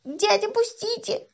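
put-on high, drawn-out child's plea; pleading squeal. A narrator's reading. Boy pinned under seat begs man, squealing. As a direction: beg to be let go.

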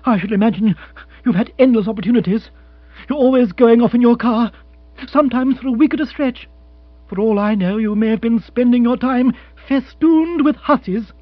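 indignant and pressing; accusing fiancée. Acted. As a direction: pile up the jealous accusations. Narrator voices Jill accusing.

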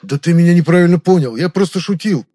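loud, high, flustered excuse; clumsy lying. A narrator's reading. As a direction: protest too much, lying badly. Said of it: "принялся неумело врать Хаосил".

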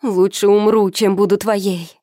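loud high desperate cry; desperate scream. A narrator's reading. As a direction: scream it with everything.

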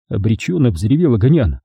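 ordinary delivery

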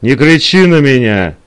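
understated angry squeal; mild shriek. A narrator's reading. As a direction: snap back in a restrained shriek. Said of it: "взвизгнула Элиза".

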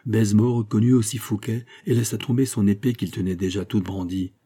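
ordinary delivery, nothing marked

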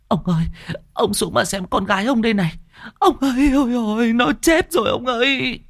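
high wailing cry of despair; keen for the dead child; wailing lament. A narrator's reading. A wife wails to her husband that their daughter is dead.

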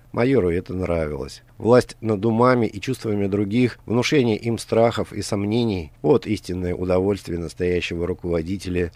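ordinary delivery

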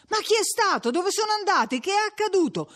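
high-pitched insistent cries; clamouring questions. A narrator's reading. Crowd demanding news from the curate, clamouring. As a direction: press for answers, crying out.

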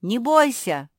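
loud call from shore. A narrator's reading. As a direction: shout across to reassure. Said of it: "крикнул с берега Тео".

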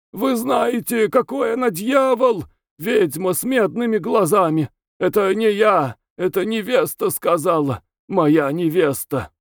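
high, raving, half-hysterical outburst; hiccuping frantic cries. A narrator's reading. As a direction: blurt it out in spasms. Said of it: "выкрикивать икающие слова". (судорожные выкрики)

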